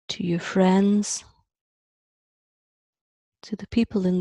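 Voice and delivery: spacious meditation voice, phrase by phrase; guided meditation. Acted. Teacher guiding a group to connect through the heart, guided meditation. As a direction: let long pauses settle between the phrases.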